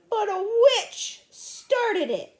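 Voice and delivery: high, slow, loud complaint; tearful. Acted. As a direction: wail the blame at someone. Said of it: "said Susan tearfully".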